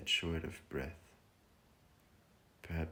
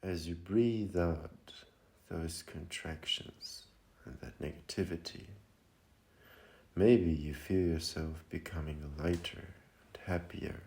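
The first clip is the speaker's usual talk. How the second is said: guided meditation. Acted. Meditation leader guiding listeners to feel lighter and centered, calm and unhurried. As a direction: lead them gently inward, phrase by phrase.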